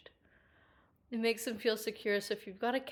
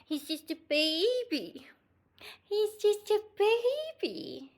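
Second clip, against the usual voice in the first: slow, pitched-up coo; 6 semitones higher, slower than usual. Real, not acted. Pet sitter showing the cat on camera, cooing.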